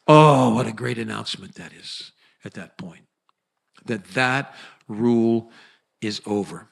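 ordinary talk, nothing marked

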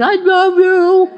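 high, drawn-out doggy howl of words; dog imitation. Imitating recordings of dogs seeming to talk, howling. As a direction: howl it slowly like a talking dog. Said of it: "dogs sounding like they're saying".

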